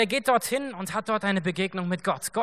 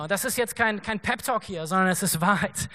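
normal talking voice

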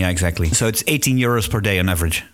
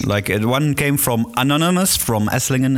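usual speaking voice

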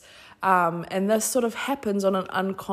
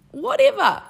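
high loud carefree whatever; playful whatever; 7 dB louder than usual. Real, not acted. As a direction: toss it off playfully. Playful dismissive exclamation.

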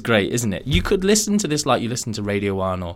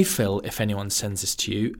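ordinary delivery

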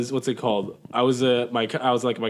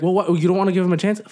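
lightly voiced, higher imitation; mock their question, pitched up; mocked relative; 6 semitones higher, faster than usual. Imitating relatives pressing about Trump, lightly mocking. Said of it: "They're like".

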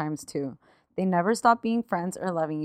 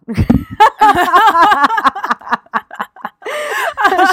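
tearful laugh; 12 semitones higher, 10 dB louder than usual. Real, not acted. Breaks down while reading story.

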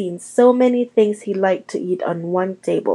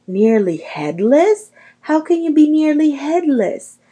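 can't believe what he just heard; amazed; 7 semitones higher, 4 dB louder than usual. Acted. Seamus to the ghost, astonished and loud.

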